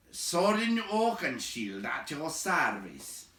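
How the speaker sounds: slow, lightly voiced dwarf greeting; bow and announce yourself. A narrator's reading. Dwarf bowing to the big man, slow formal greeting. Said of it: "said the two dwarves".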